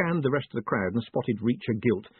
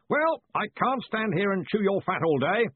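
high, hearty raised voice; blustering, impatient shout; 11 semitones higher than usual. A narrator's reading. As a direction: call out briskly across a great distance. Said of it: "shouted Ridcully".

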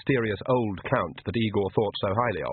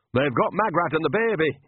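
higher, curt snap; snapped; 6 semitones higher than usual. Acted. Nanny cutting off Igor's grief, curt and higher. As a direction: cut him off sharply. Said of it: "snapped Nanny".

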